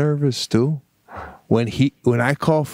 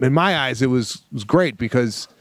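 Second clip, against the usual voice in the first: ordinary delivery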